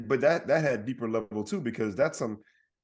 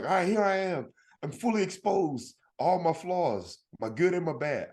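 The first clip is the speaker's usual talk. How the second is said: higher-pitched mock open confession; mock self-exposure; 7 semitones higher than usual. Comic imagining himself opening his trench coat, mock confession. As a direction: play a mild show of baring it all.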